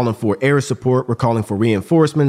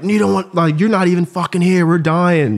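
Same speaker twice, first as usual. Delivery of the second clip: much higher, harder, furious and pleading; angry cussing out; 7 semitones higher, 3 dB louder than usual. Retold or imitated. Sniper yelling at the battalion commander who denied air support, angry. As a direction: tear into the commander who isn't even there. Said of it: "I remember cussing out a battalion commander".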